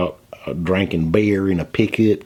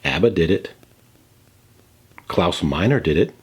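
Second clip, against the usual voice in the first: usual speaking voice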